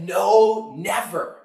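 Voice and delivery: two weighted words, "No, never"; emphatic denial. Retold or imitated. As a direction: imitate a pastor's absolute "No... never". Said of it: "he emphatically said".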